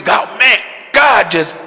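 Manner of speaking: hammed-up happy believer; mock excited testimony. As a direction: imitate a brother glowing about the word. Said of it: "brothers sharing with me".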